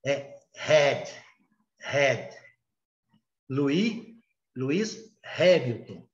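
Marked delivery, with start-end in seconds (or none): 0.1-2.4 head with a breathy h like the Portuguese r; English h in head. Shown as a demonstration. Teacher contrasts head with red for the class. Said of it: "O H".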